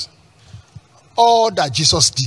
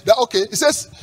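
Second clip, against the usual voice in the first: ordinary delivery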